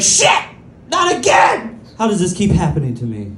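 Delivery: dismayed guy realizing he's been fooled again, high shocked yelp. Retold or imitated. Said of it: "he'd be like".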